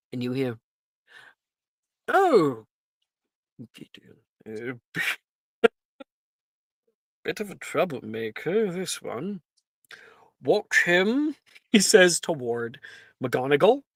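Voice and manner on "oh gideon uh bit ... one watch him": amused Sorting Hat. Acted. Sorting Hat judges Wilfred.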